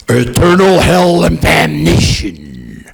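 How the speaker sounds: slow, grave pronouncement of doom; solemn heavenly judgment. Acted. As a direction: intone it like a judge of heaven. Heavenly court pronounces sentence.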